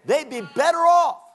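self-important church member. Acted. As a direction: play a church member sure of their own wisdom. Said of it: "what the average church member thinks".

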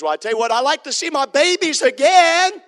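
high, loud pleading voice; mock grieving parent. A grieving churchgoer telling the preacher, high and loud. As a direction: cry it out like a longing parent. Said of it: "Somebody says".

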